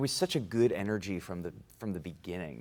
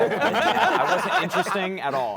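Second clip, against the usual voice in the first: laughing, higher and louder; cracking up at himself; 10 semitones higher, 10 dB louder than usual. Real, not acted. Laughing at his dull role.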